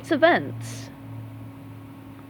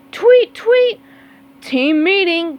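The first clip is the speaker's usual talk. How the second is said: high, loud whistle then summons; calling out; 7 semitones higher, 8 dB louder, slower than usual. Acted. Squidward calling the team on race day, loud. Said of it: "called Squidward".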